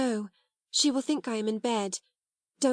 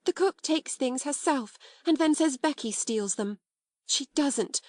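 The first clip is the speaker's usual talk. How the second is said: higher, indignant outburst; burst of outrage; 6 semitones higher than usual. A narrator's reading. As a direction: let the anger burst out. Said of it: "she burst forth".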